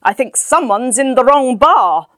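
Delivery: put-on woman at next table snubbing the drunk; loud pointed remark. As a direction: say it at the drunk, not to your husband. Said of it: "the woman said loudly".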